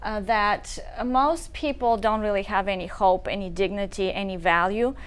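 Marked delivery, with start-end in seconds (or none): none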